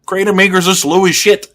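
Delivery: impatient comeback; exasperated retort. Retold or imitated. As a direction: tell them to just move, scoffing. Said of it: "It's like".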